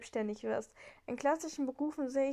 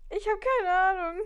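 exasperated; 6 semitones higher, 7 dB louder than usual. Real, not acted. Confused by the quiz question.

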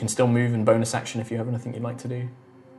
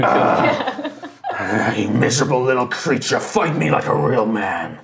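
taunting brute, lightly voiced, slow at the end; a hint of a mocking giant daring him; brute calling out; slower than usual. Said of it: "he calls out".

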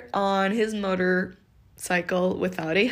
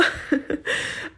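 breathy laugh; 8 semitones higher than usual. Real, not acted. Laughs about no makeup.

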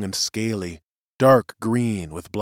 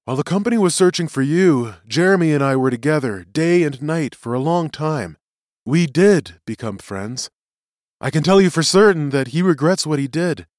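higher-pitched, earnest prisoner testifying; earnest witness; 6 semitones higher, 4 dB louder than usual. Acted. Prisoner explains himself to the tribunal, earnest. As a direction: plead the case for humans.

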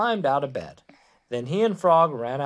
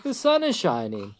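mildly excited storybook call; crying out; 6 semitones higher than usual. A narrator's reading. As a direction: urge a sleeper up, lifting the voice. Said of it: "cried Frog".

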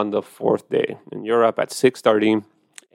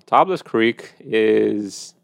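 slow emphatic; much slower than usual. Real, not acted. Names winery slowly.

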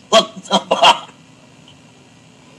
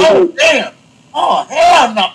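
mock disgusted shock; 6 semitones higher than usual. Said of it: "I'd be like".

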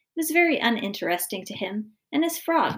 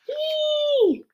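loud, very high-pitched "eee" scream; sustained girlish shriek; high squeal; 5 dB louder than usual. A narrator's reading. Daughter shrieking at the frog, high squeal. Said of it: "a loud scream from Sally".

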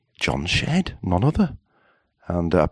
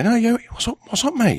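mock concerned mate. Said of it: "we say".

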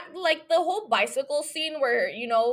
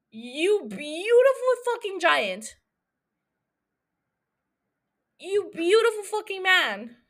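swooning; 6 semitones higher than usual. Real, not acted. Swooning over an actor.

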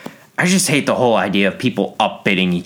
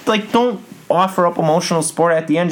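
exasperated rant; 10 semitones higher than usual. Real, not acted. Ranting against consoling friends.

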